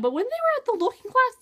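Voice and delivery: normal talking voice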